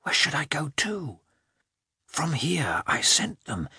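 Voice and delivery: breathy anxious whisper; hushed, unsure inner voice. A narrator's reading. Dog's thoughts, whispered.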